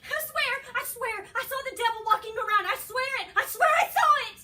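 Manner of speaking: high, loud, frantic pleading; terrified screaming plea; so scared. Acted. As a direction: beg hysterically to be believed. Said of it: "I'm so scared".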